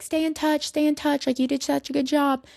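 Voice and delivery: high, sing-song send-off voice, pitched well up; mock cheery coworkers. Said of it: "everyone was just telling me".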